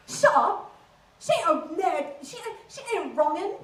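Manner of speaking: high, loud, affronted Essex lad; Essex accent. Acted. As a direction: shout it back in broad Essex. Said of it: "average conversation goes a little bit like this".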